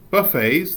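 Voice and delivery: "buffets" as buh-FAYZ, French-style ending; "buffets" ending in a long AY plus Z; silent T. Shown as a demonstration. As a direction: say "buffets" with no T sound. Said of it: "this is pronounced".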